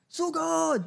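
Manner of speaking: high charging war cry; battle cry. Retold or imitated. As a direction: charge the crowd forward. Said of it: "magpapanggap siyang may gera". (sigaw sa pagsugod)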